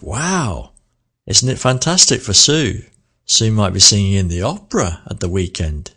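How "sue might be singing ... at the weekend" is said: big rise then fall on "opera"; surprised. Shown as a demonstration. Teacher showing unexpected news about the opera. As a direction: say "opera" as if it's a shock. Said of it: "It's unexpected".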